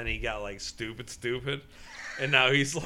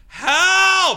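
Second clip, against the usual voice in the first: high, very loud cry for help; imitated scream; 14 dB louder than usual. Imitating Logan lost on the trail, screaming. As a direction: scream it long, mimicking a lost kid. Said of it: "telling me how he is screaming".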